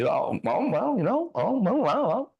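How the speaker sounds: mimicking a waffler, pitch bobbing high; imitated hedging. Student imitates his hesitant teacher stalling over class, mock hedging. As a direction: wobble up and down, mock reluctance. Voices the other man hedging.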